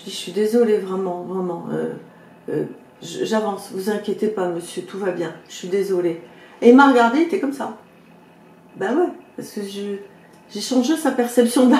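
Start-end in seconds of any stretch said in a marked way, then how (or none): 0.1-6.2 soft, apologetic retelling. Retold or imitated. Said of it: "Je dis".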